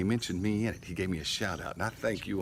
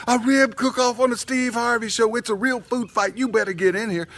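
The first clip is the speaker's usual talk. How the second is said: wife hollering; 13 semitones higher, 7 dB louder than usual. Retold or imitated. Wife urging her husband inside to watch, mock holler. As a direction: holler it insistently, imitating her. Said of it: "my wife hollers out the back door".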